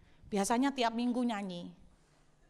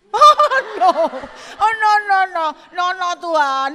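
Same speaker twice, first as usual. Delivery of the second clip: loud, high comic wail; panicked refusal; 9 semitones higher, 15 dB louder than usual. Retold or imitated. Singer begging God not to make them lie down, mock panicked wail. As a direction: cry out in hammed-up dread, sliding down from a peak. Acted refusal to God's command.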